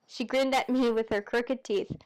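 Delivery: ordinary delivery, nothing marked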